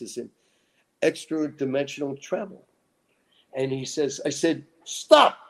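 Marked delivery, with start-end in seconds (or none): 4.8-5.4 loud, high sharp yell of stop. Retold or imitated. Lecturer yelling at a heckler to stop, loud. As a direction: cut him off with a yell. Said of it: "Man, I yell at him".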